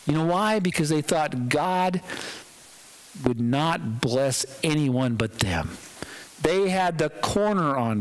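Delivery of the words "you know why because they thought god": ordinary talk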